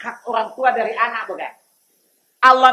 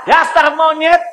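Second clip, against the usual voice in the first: louder, higher angry jeer; angry insult; 6 semitones higher, 6 dB louder than usual. Acted. Preacher voicing an angry man insulting a monkey, mock angry shout. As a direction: spit the insult out in mock anger.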